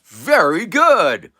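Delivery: loud, high, drawn-out praise; delighted praise. Acted. Man to his dog after its answer, delighted praise.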